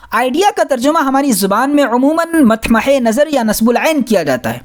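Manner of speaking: normal talking voice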